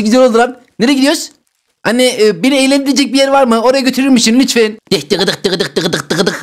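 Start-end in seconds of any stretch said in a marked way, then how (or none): 4.9-6.4 clicking hoofbeats, softer and lower; mock galloping horse noises; horse trot sound. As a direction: play horsey with clip-clop noises. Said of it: "atçılık oynayalım mı".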